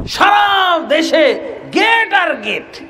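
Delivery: high, slow, drawn-out shout; slow emphatic preaching cry. Acted. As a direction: stretch each word to show the outrage. Preacher drawing out outrage.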